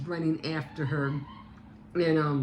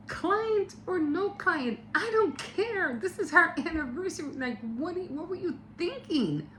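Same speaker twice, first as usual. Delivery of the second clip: very high, indignant mock rant; high-pitched retold outrage, words stretched at first; pissed-off wife; 14 semitones higher than usual. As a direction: imitate an outraged wife telling off her husband. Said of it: "really, really pissed".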